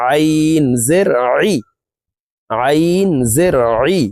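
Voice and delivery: "আইন জের আই" articulated from mid-throat; teacher's chant of Arabic pharyngeal Ayn in "আইন"; throaty Ayn. Shown as a demonstration. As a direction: squeeze "আই" from the middle of the throat. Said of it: "হলকের মধ্যখান থেকে আইন হরফের উচ্চারণ করব".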